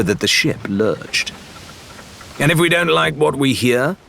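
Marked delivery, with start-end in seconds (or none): 2.4-4.0 pushing, higher and louder demand; persistent challenge. A narrator's reading. Rathbone pressing Shan for an answer, insistent. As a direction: press the question, won't let it drop. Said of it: "Rathbone persisted".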